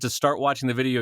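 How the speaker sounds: ordinary talk, nothing marked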